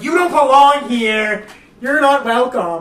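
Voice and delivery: hammed-up unwelcoming guard; mock hostile. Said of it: "I'm like".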